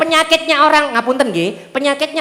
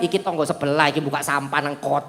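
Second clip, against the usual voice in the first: lower, softer grumbling; blaming neighbor; 9 semitones lower, 7 dB quieter than usual. Retold or imitated. Preacher mocks a flood victim blaming neighbors, low grumble. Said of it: "Nek orang belum hijrah".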